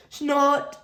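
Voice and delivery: pig snort to startle; loud snort. Acted. Pepper sneaking up on George, loud startling snort. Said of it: "runs Pepper loudly".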